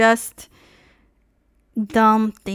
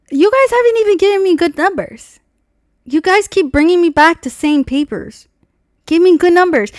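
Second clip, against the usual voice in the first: loud, higher, insistent complaint; demanding; 8 semitones higher, 8 dB louder than usual. Retold or imitated. Telling the dealers off over bad numbers, loud and demanding. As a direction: press them hard for better numbers. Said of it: "I was like".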